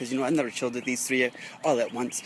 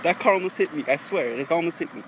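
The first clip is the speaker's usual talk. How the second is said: ordinary delivery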